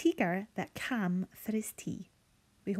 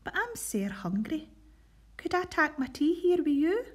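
higher and louder, a begging tiger; polite hungry tiger; 8 semitones higher, 5 dB louder than usual. Acted. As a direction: plead for tea like a hungry tiger. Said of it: "said the teager".

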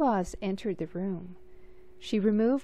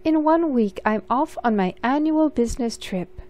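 grand announcement; 4 semitones higher, 6 dB louder than usual. Acted. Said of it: "Auntie Claus announced".

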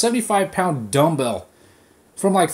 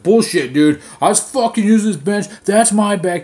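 loud, higher-pitched mock outrage; defensive; 5 semitones higher, 5 dB louder than usual. Jacked guy confronting him over the bench, defensive. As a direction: imitate an indignant gym guy staking his claim. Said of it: "he starts getting defensive".

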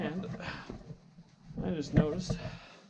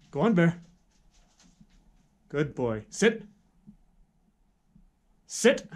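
firm dog command; 4 dB louder, slower than usual. Real, not acted.

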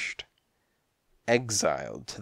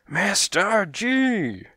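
very high, drawn-out outburst; exploding; 11 semitones higher, 4 dB louder, much slower than usual. A narrator's reading. Cat boss explodes at the detective's question, furious. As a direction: blow up on the name, stretching it out. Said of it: "he exploded".

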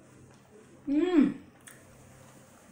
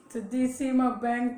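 normal talking voice

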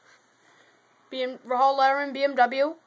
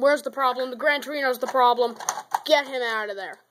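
ordinary delivery

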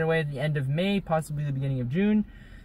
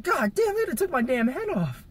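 high-pitched retold outburst; mock alarm; 11 semitones higher than usual. Son dodging a glass candle thrown by mom, mock alarmed high yelp. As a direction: exclaim it like a startled near miss.

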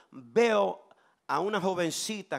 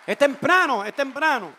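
calling out; 4 semitones higher, 8 dB louder than usual. Real, not acted. Calls back to the congregation.

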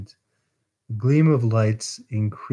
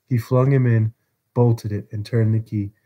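ordinary delivery

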